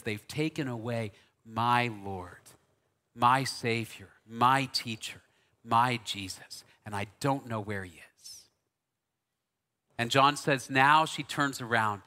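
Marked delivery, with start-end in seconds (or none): 0.0-8.5 preacher softly voicing grieving Mary; weeping Mary. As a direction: let the grief pull the words down.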